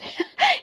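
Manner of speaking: a shrill burst of laughter; sudden high laugh. Acted. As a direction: squeal of forced glee. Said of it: "snatched the book with a laugh".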